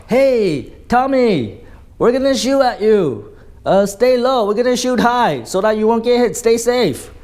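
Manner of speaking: high, loud friendly shout across; yelled warning. Retold or imitated. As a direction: call out the warning so they hear it. Germans yell warning to British.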